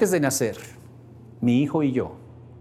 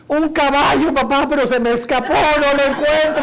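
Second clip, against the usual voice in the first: put-on much higher and louder eager child voice; excited little boy; 12 semitones higher, 7 dB louder than usual. A boy telling his father his horse ran away, excited. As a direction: chirp it out like a thrilled kid hunting for his pony. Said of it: "¿Y a ti qué te trajeron los Santos Reyes?".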